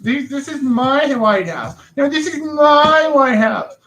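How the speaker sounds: high, slow petty squabbling voice; mocking politicians. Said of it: "and then they just".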